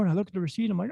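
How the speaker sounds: ordinary delivery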